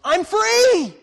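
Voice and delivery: mock widow's delighted outburst at the grave; joyful widow. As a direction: leap up and cry out your release. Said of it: "the widow, jumps up and goes".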